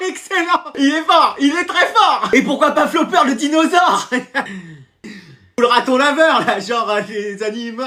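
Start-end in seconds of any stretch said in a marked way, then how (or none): 0.1-4.1 high, giggly enthusiasm; laughing approval. Acted. Classmate riffing on the joke, laughing approval. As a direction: crack up while egging him on.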